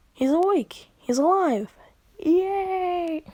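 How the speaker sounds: high, loud, celebratory cry; excited cheering. Acted. Onlookers see him wake from a coma, excited cheering.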